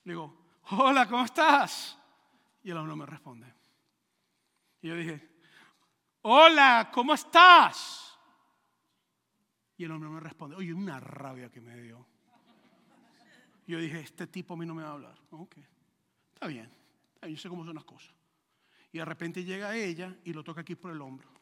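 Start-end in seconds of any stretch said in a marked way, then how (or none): 0.7-2.0 louder, drawn-out hello; insistent greeting. Retold or imitated. Pastor greeting a man who did not answer, loud and insistent. 6.2-8.2 very loud, high, drawn-out hello; exasperated shouted greeting. Retold or imitated. Pastor's third try at the silent man, exasperated shout. 14.0-18.1 low, quiet grumbling aside; offended grumble. Retold or imitated. Snubbed pastor grumbling to himself, low and offended.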